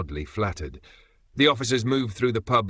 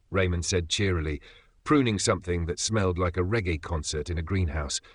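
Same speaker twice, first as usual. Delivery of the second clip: ordinary delivery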